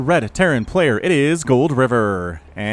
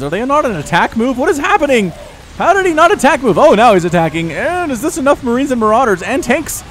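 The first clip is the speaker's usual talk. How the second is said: excited shout; 9 semitones higher, 7 dB louder than usual. Real, not acted. Caster erupts at sudden attack.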